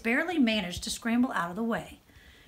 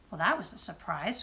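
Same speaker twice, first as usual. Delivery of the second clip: plain reading voice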